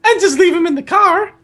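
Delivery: high falsetto aunt voice; Aunt Petunia. Acted. Aunt suggesting they leave him, high falsetto. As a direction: finish the thought in a piping girl voice.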